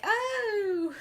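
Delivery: high, laughing "Oh!"; a swooning, giggly little gasp; mock gasp of surprise. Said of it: "she's like".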